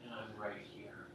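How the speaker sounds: unvoiced, very soft whisper; God whispering in the heart; whispered inner voice. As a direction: breathe it like a secret. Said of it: "hear in the depth of my heart".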